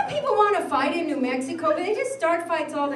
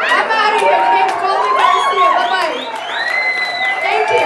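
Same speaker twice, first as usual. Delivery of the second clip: higher, louder exit line; loud sign-off; 4 semitones higher, 8 dB louder than usual. Real, not acted. Comic signing off to the crowd, loud and high. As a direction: belt the goodbye to the room. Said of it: "sign-off".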